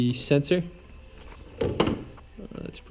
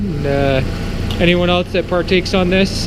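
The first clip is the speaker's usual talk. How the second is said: raised voice over a running engine; shouting over engine; 4 semitones higher, 5 dB louder than usual. Real, not acted. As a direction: project loudly over the engine noise. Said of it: "hopefully you can hear me over the engine".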